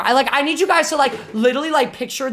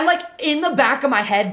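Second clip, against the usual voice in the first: ordinary talk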